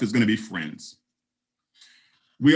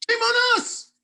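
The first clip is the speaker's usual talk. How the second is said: mock shame; slower than usual. Real, not acted. Mock scolding exclamation.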